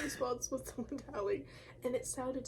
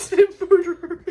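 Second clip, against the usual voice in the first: very loud, high words through laughter; laughing; 6 semitones higher, 19 dB louder than usual. Real, not acted. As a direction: blurt it out while laughing. Cracking up at the story.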